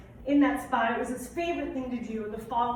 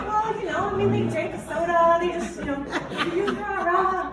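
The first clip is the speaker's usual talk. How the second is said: higher, louder giggly teasing; laughing mock explanation; 6 semitones higher, 5 dB louder than usual. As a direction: tease him through laughter. Retold reply to Dave, laughing.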